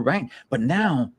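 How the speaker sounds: normal talking voice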